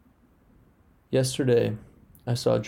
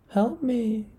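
very high, thin cry of a tiny germ; tiny germ crying for help; 11 semitones higher than usual. A narrator's reading. A tiny germ crying up to her rescuer, high and thin. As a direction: squeak out a desperate plea. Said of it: "she cried".

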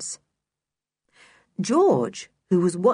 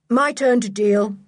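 loud, high announcement; raised voice, putting on a show; 5 semitones higher, 5 dB louder than usual. A narrator's reading. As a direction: call it out for eavesdroppers to hear. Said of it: "said Julian loudly".

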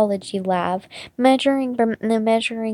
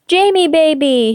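high, loud gushing producer; 6 semitones higher, 5 dB louder than usual. A narrator's reading. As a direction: gush over him, loud and high. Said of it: "he gushes".

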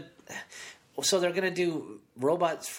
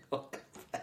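whispered laughing welcome back; 3 semitones higher, 12 dB quieter than usual. Real, not acted. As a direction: echo it through a giggle. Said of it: "Why are you laughing?".

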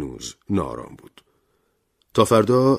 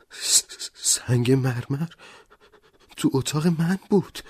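high, stammering, slow fear; trembling voice; 10 semitones higher, slower than usual. Acted. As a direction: stammer it out, shaking. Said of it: "با صدایی لرزان گفت".

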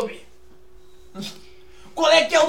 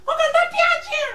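Super Histérico impression; 12 semitones higher than usual. Shown as a demonstration. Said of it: "imitação do Super Histérico".

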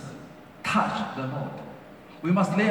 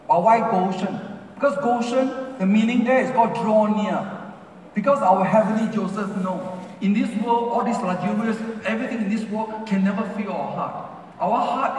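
louder and higher, driving the point home; full-force sermon delivery; fervent preaching; 3 semitones higher, 4 dB louder than usual. Acted. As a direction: preach it with rising fire. Preaching builds to a high point.

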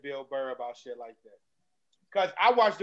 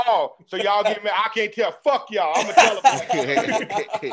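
louder, mock-defiant comeback, laughing at the end; comedian's retort; 2 semitones higher, 7 dB louder, slower than usual. Comic on stage telling off the crowd, loud. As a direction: throw it back at the crowd, louder.

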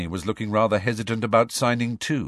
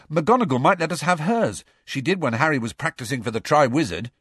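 lightly voiced schoolboy, pitched up; suggesting Ron; 7 semitones higher, 3 dB louder than usual. Schoolboy suggesting a classroom to meet in, pitched up. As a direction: offer the idea eagerly, starting high.

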